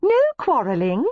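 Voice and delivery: high, lilting cheerful scold; gaily. A narrator's reading. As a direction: cheerfully break up the squabble. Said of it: "Lady Miranda said gaily".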